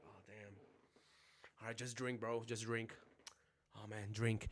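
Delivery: soft, low murmur to a sad friend; hushed, pitying urge to just drink; hushed consoling. Retold or imitated. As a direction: quietly nudge them to drink.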